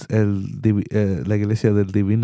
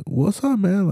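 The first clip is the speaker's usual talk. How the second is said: higher, light eager greeting; excited cousin greeting; 9 semitones higher, slower than usual. Retold or imitated. Cousin greeting his uncle the manager, a higher eager voice. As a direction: sound glad to spot a relative at work.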